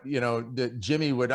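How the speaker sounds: ordinary talk, nothing marked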